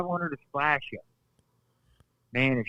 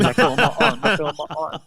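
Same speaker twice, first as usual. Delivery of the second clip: laughing; 6 dB louder, faster than usual. Retold or imitated. Laughing while retelling.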